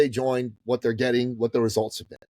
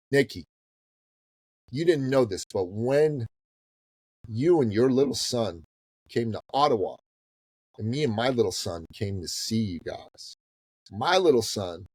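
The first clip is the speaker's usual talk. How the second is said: ordinary talk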